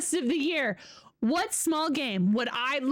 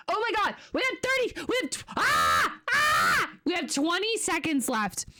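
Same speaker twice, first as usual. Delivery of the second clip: rising excited yelp; excited panic; 5 semitones higher than usual. Real, not acted. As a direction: panic as time runs out. Countdown panic near midnight.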